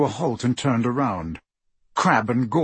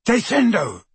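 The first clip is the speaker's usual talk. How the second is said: shouted spell; 9 semitones higher than usual. A narrator's reading. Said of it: "and shouted".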